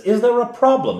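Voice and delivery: high, loud pushy challenge; challenging question. Acted. Eldest son's character confronting the Reeve, high and loud. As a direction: square up to the Reeve with a sharp question.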